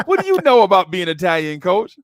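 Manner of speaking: loud, high, fast mock challenge; jiving. Host ribbing the coach about being Italian, mock-indignant. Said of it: "I'm jiving you".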